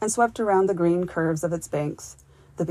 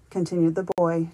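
usual speaking voice

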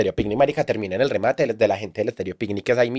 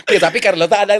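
higher laughing delivery; 8 semitones higher, 5 dB louder than usual. Real, not acted. Joking about Xanax at the clinic, laughing. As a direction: push the words through a laugh.

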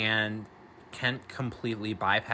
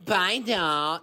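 higher, louder sneering echo of "Bye, doll"; mocking; 7 semitones higher, 5 dB louder than usual. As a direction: mimic "Bye, doll" to taunt. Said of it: "these fucking losers walk up behind him and go".

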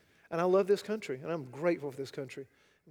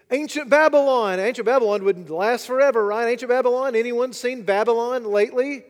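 preaching at full force; 5 semitones higher, 11 dB louder than usual. Acted. Preacher to congregation, full-force preaching. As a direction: hammer the point home to the congregation. Roll call of nations.

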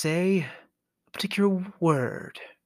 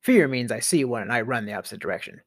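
ordinary talk

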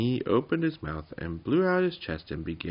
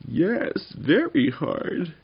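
high, loud, slow insistence; little creature's high protest; aggrieved little goblin; 12 semitones higher, 7 dB louder, much slower than usual. A narrator's reading. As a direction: stretch it out as a small goblin. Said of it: "said the other".

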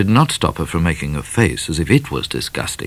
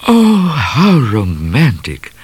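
slow, high gushing delight; cried out delighted; 7 semitones higher, 4 dB louder, slower than usual. A narrator's reading. Miss Laura gushes to Cat, slow delighted cry. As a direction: swoon over it. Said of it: "clapped her hands and cried".

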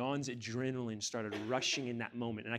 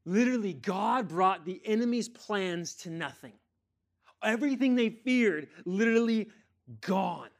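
high, loud, triumphant proclamation; full-force preaching; 6 semitones higher, 8 dB louder than usual. Real, not acted. Preacher declaring enemy's plans brought to nothing, triumphant. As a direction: punch out each word in victory.

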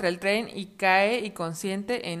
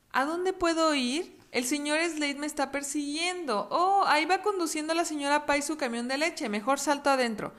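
scared fox; 6 semitones higher than usual. Acted. El zorro huye asustado.

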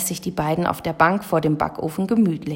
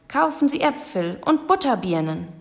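fruit sellers' call; 7 semitones higher than usual. A narrator's reading. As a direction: sing out the wares like a fruit vendor. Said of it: "Die Obsthändler riefen in einem fort". (Marktruf der Obsthändler, hohe Stimme)

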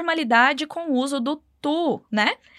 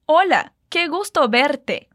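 slower, careful repeat of Hola, qué gusto verte; slower than usual. Shown as a demonstration. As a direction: say it again slowly for the learner. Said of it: "Mais uma vez".

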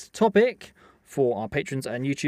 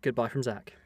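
usual speaking voice

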